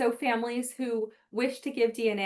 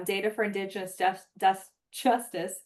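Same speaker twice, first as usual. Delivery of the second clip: stumbling over the last word, restarting it; tongue-tied. Real, not acted. Presenter tired after an hour of talking, tongue-tied. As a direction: trip on "Justice" before getting it out. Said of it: "I'm getting tongue-tied".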